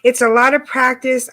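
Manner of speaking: ordinary talk, nothing marked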